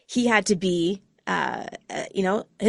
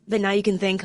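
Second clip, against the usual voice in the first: normal talking voice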